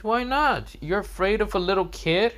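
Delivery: put-on high-pitched, taunting kid; Boruto. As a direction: goad him in a high kid's voice. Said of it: "Boruto says".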